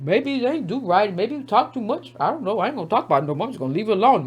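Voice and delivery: quiet mock evasion; mock mumbling. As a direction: shrink away from the question. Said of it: "everybody quiet".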